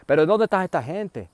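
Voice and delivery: high, loud shout of where are they; loud calling out. Retold or imitated. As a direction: call out over the noise, searching. Relatives calling loudly at station.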